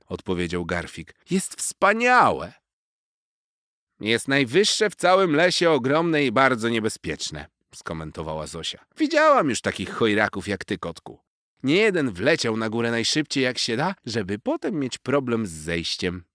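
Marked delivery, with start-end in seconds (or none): 1.3-2.5 high, loud and slow awe; excited. A narrator's reading. Young cat admiring the tall tree, excited. 4.0-16.2 high, loud wise little squirrel warning; a cheeky squirrel teasing a show-off kitten; squirrel Zosia. A narrator's reading. Squirrel warning the boastful cat, high and loud.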